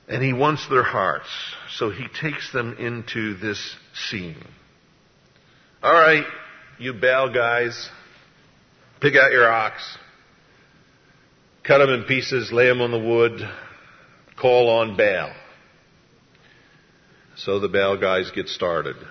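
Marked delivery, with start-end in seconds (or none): 5.8-15.3 loud, commanding challenge; louder, projected challenge; calling out orders. Acted. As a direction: bark out orders to a rival crew. Voicing Elijah's orders to prophets.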